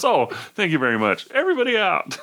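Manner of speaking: lightly voiced official clearing the room; mock Renault. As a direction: play the smug officer, ending high. Renault pockets winnings.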